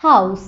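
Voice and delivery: হাউস, the s unvoiced; s sound in house. Shown as a demonstration. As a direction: end হাউস on a clean s. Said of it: "এরকম হবে".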